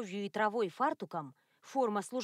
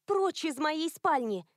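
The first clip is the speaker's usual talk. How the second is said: high, slow, angry order; angry shout; 6 semitones higher than usual. A narrator's reading. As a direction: drive him out, slowly. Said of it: "выкрикнула она".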